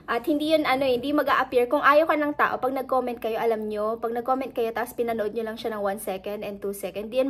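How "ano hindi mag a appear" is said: ordinary delivery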